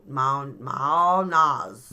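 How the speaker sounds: "Maonas" with the first syllable stretched long; sounding it out. Shown as a demonstration. Co-host attempting the rune name, sounding it out. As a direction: sound out "Maonas" very slowly, drawing out MAO. Trying out the corrected pronunciation.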